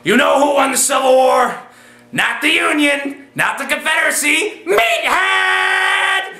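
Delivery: high building yell up to Meathead; climbing up to a screamed catchphrase; rising shout. Retold or imitated. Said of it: "And I said".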